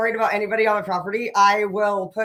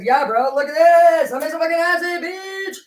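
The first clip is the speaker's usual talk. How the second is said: high, very loud show-off yell; excited bragging shout; 7 semitones higher, 8 dB louder than usual. Retold or imitated. Showing her brother the acid she made, an excited loud brag. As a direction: shout it out proudly like a trophy.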